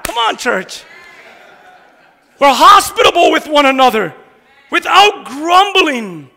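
loud, high-pitched preaching; impassioned preaching. Real, not acted. Preacher rallying the church to serve without grumbling, impassioned preaching. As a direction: fire up the congregation at full force. Preacher declaring eager, willing service.

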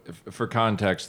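ordinary talk

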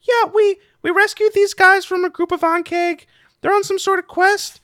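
high-pitched little creature voice; Griddle. Acted. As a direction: speak up high as a cave guide.

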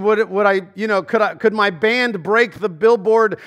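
ordinary talk

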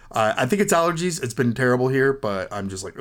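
ordinary talk, nothing marked

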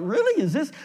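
high, stunned disbelief; a squeaky, half-laughing "really?"; incredulous. Retold or imitated. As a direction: retell the shock of being laid off. Laid off before signing papers.

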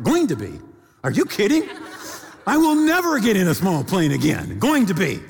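high, indignant mock outburst; incredulous retort. Incredulous retort to wife's warning.

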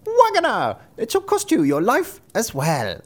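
shrieked high name, then menacing threat; high bird voice calling out a threat; sparrow. A narrator's reading. Sparrow threatening the wagoner, high menacing cry. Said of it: "answered the sparrow".

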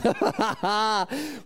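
delighted laugh. Real, not acted. Preacher laughing at his own story, delighted laugh. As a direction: laugh at the punchline.

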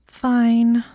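loud, high, drawn-out huff; exasperated sulky surrender; sulky, dramatic. A narrator's reading. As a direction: give in with a dramatic sulk. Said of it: "She gave a dramatic sigh".

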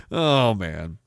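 ordinary delivery

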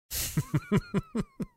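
very high, comic giggle. Acted. Streamer joking about a creepy book, high put-on giggle. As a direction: let out a tittering put-on giggle.

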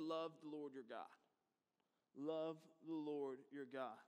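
low, soft and reverent; hushed, solemn. Real, not acted. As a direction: let the verse land quietly and low. Solemn repeat of Jesus' answer.

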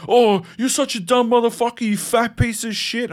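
high, sneering mock insult; imitating the driver's insult. Bald driver jeering at him, high sneering taunt. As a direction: spit it out like a jeering bully.

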